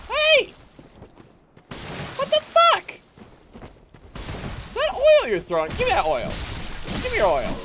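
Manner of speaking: shrieking high yell; outraged yell. Real, not acted. Gamer yelling at an enemy who hit him, shrieking. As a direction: scream at the attacker in shock.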